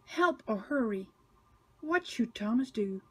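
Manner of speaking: usual speaking voice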